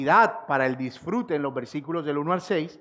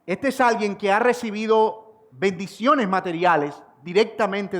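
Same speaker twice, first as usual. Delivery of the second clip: higher, louder rhetorical questions from the pulpit; impassioned preaching; 5 semitones higher, 5 dB louder than usual. Acted. Preacher challenging his congregation, impassioned and louder. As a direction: press the congregation with question after question.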